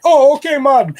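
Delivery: put-on high, loud Jamaican accent; Jamaican Rasta accent. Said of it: "went right back into it".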